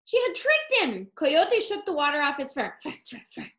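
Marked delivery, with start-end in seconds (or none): 2.8-3.5 soft shaking sound. Acted. Coyote shakes off water.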